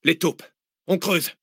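high barked orders; military command. Acted. Zach ordering the others to dig, military command. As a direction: command the troops. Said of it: "presque militaire".

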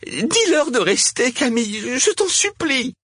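very high, urgent begging; desperate pleading. A narrator's reading. Cosima begging Camille to keep the crowd, desperate.

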